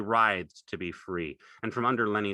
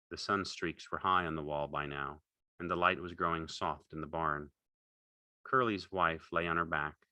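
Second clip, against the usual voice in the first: plain reading voice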